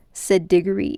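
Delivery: usual speaking voice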